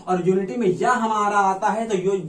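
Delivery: ordinary talk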